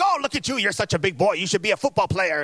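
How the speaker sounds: high-pitched doting grandma impression; sweet loving grandma. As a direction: gush over a grandchild. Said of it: "most loving grandma. She's like".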